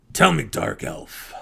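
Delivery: put-on a hearty old gnome asks the dark elf; hearty deep gnome. A narrator's reading. Gnome asks the drow, lightly voiced and hearty. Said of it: "in his hearty, melodic voice".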